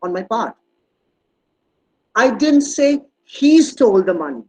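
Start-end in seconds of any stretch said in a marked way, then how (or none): 2.1-4.4 "he" raised and punched, slow delivery; slow sentence with an emphatic, lengthened "he"; stress on HE. Shown as a demonstration. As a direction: hit "he" hard, as if singling out that person. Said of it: "it could be somebody else".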